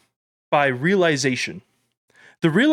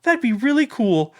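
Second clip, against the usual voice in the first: put-on excited kid; 9 semitones higher than usual. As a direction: gush like a kid about Superman. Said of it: "they're like".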